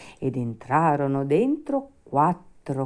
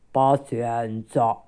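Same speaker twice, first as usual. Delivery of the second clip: very slow creature voice; resigned rabbit undertaker; 3 semitones lower, 3 dB louder, much slower than usual. Acted. Rabbits give up on taking the puppet, resigned. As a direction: draw it out in gloomy resignation.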